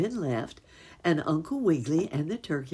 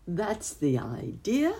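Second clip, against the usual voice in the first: higher, slow eager exclamation; cried out; 7 semitones higher, slower than usual. Acted. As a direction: call it out gladly, stretching it. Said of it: "cried the bunny".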